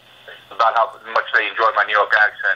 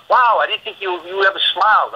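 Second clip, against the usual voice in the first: mock surprise; 9 semitones higher than usual. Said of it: "they're like".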